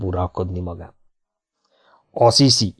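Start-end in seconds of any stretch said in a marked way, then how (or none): 2.1-2.7 short mocking challenge. A narrator's reading. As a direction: toss it out with a sneer. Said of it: "kérdezte az óriás gúnyosan". (gúnyos visszakérdezés)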